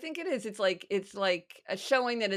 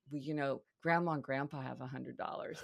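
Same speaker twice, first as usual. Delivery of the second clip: hushed, weighty reveal as a mother; softly imitated mom, playing it up; quietly dramatic; 3 semitones lower, 7 dB quieter than usual. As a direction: lower the voice to impart a big secret. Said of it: "said it in this kind of dramatic way".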